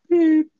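loud, held vocal bleep; imitated censor beep. Shown as a demonstration. Podcaster warning listeners about the censored name, imitated beep. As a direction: sound out a long censor tone. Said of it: "if you hear the following sound".

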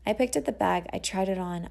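ordinary delivery

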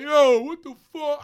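loud, high, slow yell; mock scream across the bar. Shown as a demonstration. Showing what he did not do, a loud high yell across the bar. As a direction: belt it out across the room. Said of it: "it wasn't like I screamed it across the bar".

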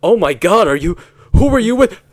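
high, loud shocked voice, slowing at the end; shocked spouse. Acted. Wife catches Todd coming home early, shrill shock. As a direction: play the stunned spouse catching them out. Acted caught-cheating scene with Todd.

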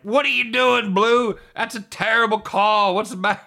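high, loud angry heckle at the ump; sports dad hollering at the ref; mock yell at the umpire. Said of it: "You're like".

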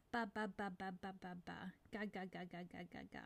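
lightly voiced baby babble, fading away; reduplicated baby babble. Shown as a demonstration. Therapist showing parents reduplicated babble, softly babbling. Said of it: "the same syllable is pronounced over and over".

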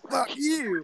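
high, drawn mock retort; hammed-up squeal of defiance; Anthony. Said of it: "and then Anthony's like".

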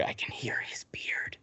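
breathy hushed whisper, almost silent; a secret whisper of awe. Retold or imitated. Zoe hushed in the screening room.

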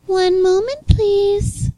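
polite voice. Retold or imitated. Wendy's worker politely asks him to wait, high sweet voice. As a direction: chirp it like a polite drive-thru worker. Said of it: "In a polite voice".

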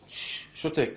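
whispered shush when angry. Shown as a demonstration. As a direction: whisper shhh then שותק. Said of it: "ברגע של כעס".

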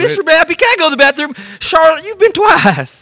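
high, loud whiny student; whiny kid voice. Pretend. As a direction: whine it like a little kid. Kid and teacher skit.

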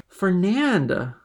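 high, loud cry of the name; reproachful cry. A narrator's reading. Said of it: "cried Mercedes".

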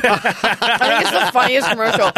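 ordinary talk

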